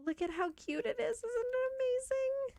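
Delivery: high, delighted gush over the bakery; showing off the cozy bakery, gushing high. Real, not acted. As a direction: squeal with delight at how cute it is.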